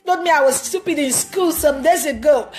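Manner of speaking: a hint of a petulant kid; imitating a whining child. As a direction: play the child making excuses for crying. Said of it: "They'll tell you".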